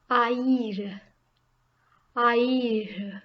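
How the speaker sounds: "Haïr" as two syllables, a-EER, silent h; both vowels pronounced. Shown as a demonstration. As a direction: sound a and ï separately in "Haïr". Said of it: "both need to be pronounced".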